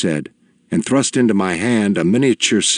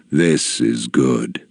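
low, slow, soft elder's voice; a gentle, deep-voiced old sage; soft deep old man; 8 semitones lower, much slower than usual. A narrator's reading. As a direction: speak low and unhurried like a wise old leader. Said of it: "His voice was soft and deep".

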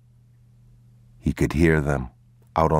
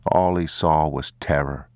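usual speaking voice

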